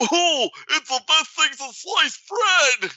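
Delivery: high, slow starry-eyed gushing; mocking gushing fan. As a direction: gush like a dazzled fool.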